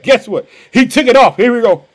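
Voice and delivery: usual speaking voice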